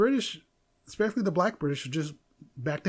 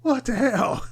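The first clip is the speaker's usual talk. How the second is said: stunned disbelief; 6 semitones higher, 4 dB louder, slower than usual. Retold or imitated.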